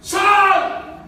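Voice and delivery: high, loud call into the tomb; Jesus calling Lazarus out of the tomb, shouting; shouted command. Retold or imitated. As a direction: cry out to raise the dead. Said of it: "Y le grita a Lázaro".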